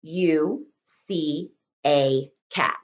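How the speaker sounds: very slow, every word drawn out; slow careful dictation for children. Shown as a demonstration. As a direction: dictate each word slowly and clearly. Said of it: "Five words".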